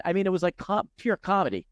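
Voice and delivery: ordinary delivery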